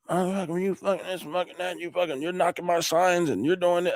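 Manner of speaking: a mock rant from the angry man on the phone; angry caller. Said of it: "pissed, cussing at me".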